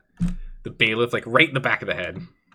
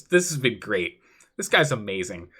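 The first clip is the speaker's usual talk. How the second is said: ordinary talk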